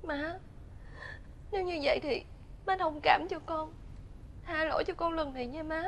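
nói rất khẽ, khẩn khoản xin tha; thì thầm nài nỉ mẹ chồng; van xin nhỏ nhẹ. Acted. Con dâu nhỏ giọng van xin. (con dâu nói khẽ van xin má tha lỗi)

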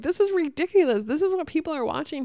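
high, loud, incredulous protest; indignant outburst. Retold or imitated. Pausing the video to protest to partner, indignant outburst. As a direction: exclaim in exasperated disbelief.

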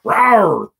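loud playful rawr; hammed-up tough-guy roar; mock growl. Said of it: "I'm about to".

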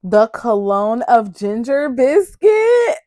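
loud, slow, disbelieving shout; excited shout. Real, not acted. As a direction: yell it out in thrilled disbelief. Unboxing a longed-for cologne.

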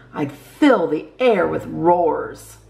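usual speaking voice

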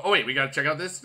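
ordinary talk, nothing marked

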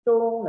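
CHUNG held high and level; first tone. Shown as a demonstration. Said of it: "nada satu". ("Chung" nada satu, tinggi datar)

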